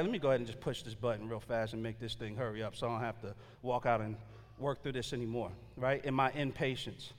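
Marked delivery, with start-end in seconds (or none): 0.0-5.5 low hushed inner thought. Retold or imitated.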